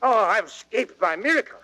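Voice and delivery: ordinary delivery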